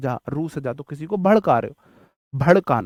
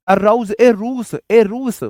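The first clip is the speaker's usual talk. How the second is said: louder and higher, slow, hammering the memory trick; excited shout; 7 semitones higher, 7 dB louder, slower than usual. Shown as a demonstration. Teacher excitedly yells the mnemonic to the class, loud and high. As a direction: call out ए रूस like teasing a sibling. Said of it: "teacher memory trick shouted".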